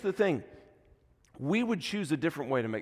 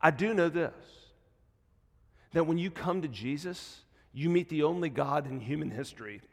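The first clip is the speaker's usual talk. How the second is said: usual speaking voice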